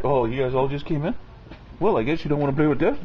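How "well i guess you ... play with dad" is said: ordinary delivery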